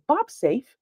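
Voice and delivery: woman's voice. Retold or imitated. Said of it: "you hear a woman's voice say".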